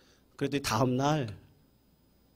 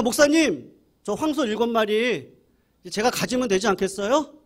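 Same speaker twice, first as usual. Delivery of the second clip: eager hopeful plea; 8 semitones higher, 4 dB louder than usual. Retold or imitated. Farmer eagerly asking to keep bulls.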